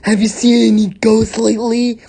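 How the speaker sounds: high, loud, quick taunt; cackling bully. A narrator's reading. Bertha mocks Poopsie in front of Grubs, loud cackling taunt. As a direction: cackle it out in a put-on voice. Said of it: "cackled Bertha".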